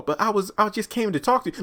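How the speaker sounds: high, put-on meek excuse; mock pleading. Lacey explaining herself to Elder Mother, mock pleading.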